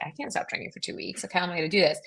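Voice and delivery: hushed, breathless disbelief; whispered dismay. Retold or imitated. Telling friends about the doctor's order, whispered mock despair. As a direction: whisper it like it's unthinkable. Reacting to doctor's two-week ban.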